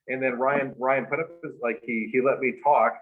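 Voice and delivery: ordinary talk, nothing marked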